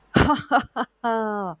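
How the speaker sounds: a loud, gloating villain's cackle; menacing evil laugh; fearsome cackle. A narrator's reading. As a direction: cackle down into the pit like a cruel cat. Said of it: "का खौफनाक कहकहा सुनाई दिया".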